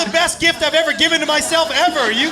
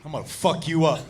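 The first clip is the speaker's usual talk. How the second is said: quiet threat; 8 semitones lower, 4 dB quieter than usual. Retold or imitated. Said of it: "something in him just snapped".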